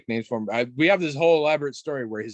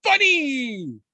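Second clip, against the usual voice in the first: put-on high, louder sing-song little-kid voice; proud kid punchline; 14 semitones higher, 5 dB louder than usual. Little nephew finishing his knock-knock joke, high and proud. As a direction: deliver it like a tiny comedian.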